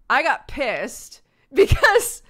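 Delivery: laughing. Retold or imitated. Retelling brother's anger.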